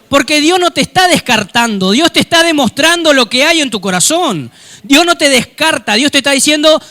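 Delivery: high, loud fervent preaching; loud, rising pulpit fervour; preaching at full force. Acted. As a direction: drive it home to the congregation. Preaching climax.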